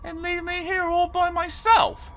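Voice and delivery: put-on high, pleading child voice; pleading boy. A narrator's reading. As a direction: beg him not to leave you alone. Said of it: "said Arthur".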